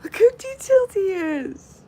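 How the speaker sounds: delighted collector gushing; excited gush. Real, not acted. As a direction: squeal over the detail. Gushing over figurine.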